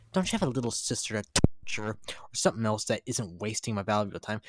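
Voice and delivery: ordinary talk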